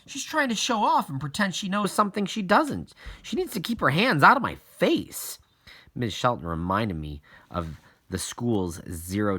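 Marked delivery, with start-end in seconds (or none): 0.1-5.4 higher, louder indignant protest; a kid insisting she's been wronged; indignant signing. A narrator's reading. Iris to the principal, higher indignant protest. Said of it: "I signed".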